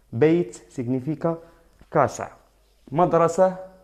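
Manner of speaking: ordinary delivery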